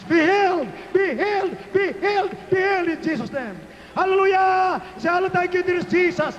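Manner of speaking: high, insistent commanding cry; full-force healing preaching. Real, not acted. Preacher commanding the sick to be healed, high rallying shout. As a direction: call it out over the crowd, repeating with fervor.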